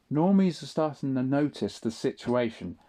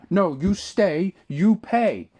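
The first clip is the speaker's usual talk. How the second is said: slow, louder, higher put-on foreign voice; mimicking the cashier; 4 semitones higher, 6 dB louder, slower than usual. Cashier ordering him to stay and pay, slow loud mimicry. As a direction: spell out the order word by word as the worker. Cashier insists again, retold.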